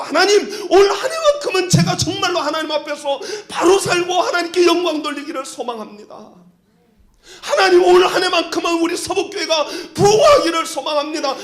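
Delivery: loud fervent prayer, shouted high. Retold or imitated. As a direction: cry out the prayer with full force. Pastor acts out his loud New Year prayer. (큰 소리로 외치는 기도)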